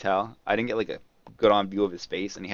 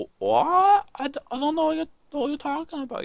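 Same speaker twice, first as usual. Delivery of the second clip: mock nervous thief, pitched way up; 4 dB louder than usual. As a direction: play the caught thief, all innocence.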